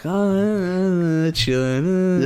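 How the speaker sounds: high-pitched drawn-out mock performance; mimicked rapper.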